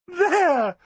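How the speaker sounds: high triumphant exclamation. A narrator's reading. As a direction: crow it out victorious. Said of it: "said Flossie in triumph".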